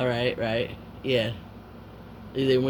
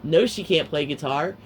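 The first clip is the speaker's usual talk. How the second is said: ordinary talk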